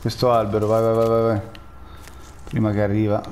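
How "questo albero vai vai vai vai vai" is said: ordinary talk, nothing marked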